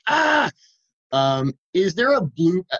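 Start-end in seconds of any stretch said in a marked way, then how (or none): 0.1-0.5 high-pitched imitated yell, "ah!"; short yell mimicking an exasperated quarterback; mock angry yell. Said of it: "started yelling at everybody like".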